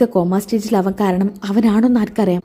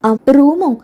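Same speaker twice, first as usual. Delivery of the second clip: higher, surprised question; voiced surprise, pitched up; taken aback; 6 semitones higher, 4 dB louder, slower than usual. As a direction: react startled to the suggestion. Girlfriend startled at room suggestion.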